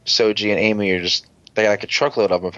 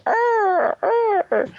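very high squeaky creature call; robot creature growl. Retold or imitated. Robot sidekick calling out, very high creature squeak. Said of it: "He was just like".

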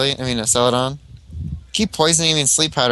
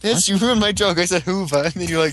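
ordinary talk

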